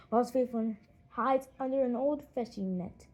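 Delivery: ordinary delivery, nothing marked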